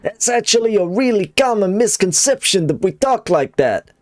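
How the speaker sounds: high, indignant New York character; New York accent. Acted. New Yorker objecting to being mocked, high New York accent. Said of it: "Fine New York accent".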